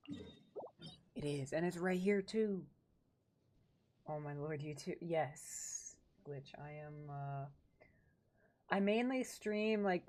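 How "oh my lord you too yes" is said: usual speaking voice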